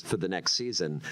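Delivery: usual speaking voice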